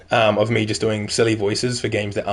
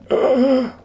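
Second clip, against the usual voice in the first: put-on high, strained old man groan; 5 dB louder than usual. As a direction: croak it like a creaky old man. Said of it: "the old man accent's fun to do".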